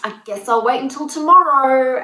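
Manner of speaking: loud, high-pitched parody of giving up; mock resignation. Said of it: "brushed off and".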